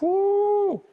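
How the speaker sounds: high delighted whoop over great food; excited whoo. Real, not acted. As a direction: let out a thrilled, squealing whoo. Raving about the pastrami.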